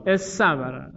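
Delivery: استبره said as essabbara, s doubled; assimilated form. Shown as a demonstration. Teacher demonstrating the merged form to students, doubled s.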